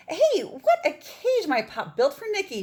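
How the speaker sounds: loud, high proud shout to friends; yelled. Acted. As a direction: yell it out, proud of the cage. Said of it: "she yelled".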